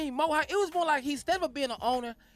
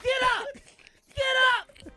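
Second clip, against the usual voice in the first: higher, a mock rally shout; imitating the owner's yell; 11 semitones higher than usual. Cohost mimics the Clippers owner rallying fans, mock shout. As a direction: egg the crowd on like the owner. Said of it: "He made the people stand up".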